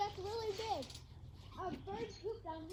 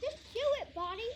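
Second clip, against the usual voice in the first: self-urging shout; 4 semitones higher, 5 dB louder than usual. Real, not acted. Urging herself mid-jump.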